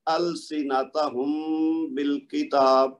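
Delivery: slow السنتہم, its meem held nasally into بالکتاب; tajweed recitation. Shown as a demonstration. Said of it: "ان کو one by one سب کو پڑھتا ہوں".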